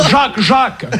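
laughing through the punchline, pitch jumping up; can't hold it together, giggling out the words. Real, not acted. Telling the punchline to the radio audience, laughing.

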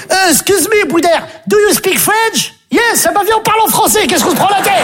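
high-pitched comic outburst; exasperated schoolboy. Acted. Pupil in English oral asks classmate for French, high exasperation. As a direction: push it high as you give up on English.

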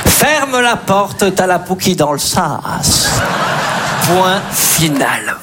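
slow, drawn-out classroom dictation; mock-solemn schoolteacher, stretching the syllables; teacher giving dictation. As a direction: read it out slowly for pupils to write down. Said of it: "vous imaginez les dictées".

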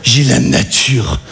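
very slow, low echo; mock echo of mum, slow. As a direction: drag out her words mockingly. Son mimics mother's words.

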